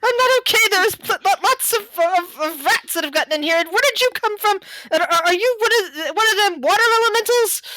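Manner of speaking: high, frantic country voice; flustered elf farmer. Acted. Farmer elf frets to the stranger about rats, flustered. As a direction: babble it high and rattled.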